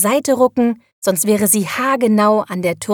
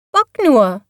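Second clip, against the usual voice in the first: raised, falling call; 4 semitones higher, 5 dB louder than usual. A narrator's reading. Little witch calls to her bucking broom, calling out. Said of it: "rief sie dem Besen zu".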